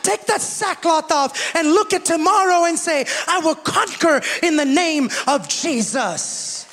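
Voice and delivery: high, loud, driving sermon climax; full-force preaching. Acted. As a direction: preach it to the rafters, slowing on the promises.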